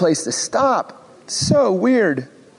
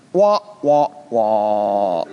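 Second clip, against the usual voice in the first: sinking wah wah, dropping low on the last; sad trombone wah wah; 11 semitones lower, much slower than usual. Acted. Preacher mocking the anticlimax with a falling wah wah. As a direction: sound the comic letdown. Mock letdown after God sends Moses.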